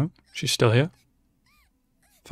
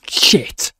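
ordinary delivery

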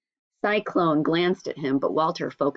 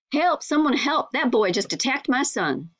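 mock cry for help; 8 semitones higher than usual. Father calls out for help.

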